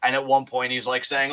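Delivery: ordinary talk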